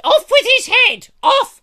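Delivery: shrieked high royal command; screaming order of execution; very loud, high mock queen yell; Queen Victoria shouting. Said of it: "I'm going into Queen Victoria".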